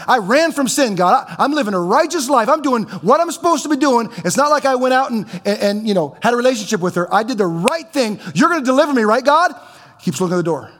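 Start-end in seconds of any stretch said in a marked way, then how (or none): 0.1-9.5 high, loud, desperate plea; pleading with God. Acted. Joseph in prison pleading loudly with God, high and desperate.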